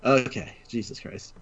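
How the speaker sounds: recovering from laughter, breathless; after laughing hard. Real, not acted. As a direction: sigh out the last of the laugh. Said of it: "compose myself".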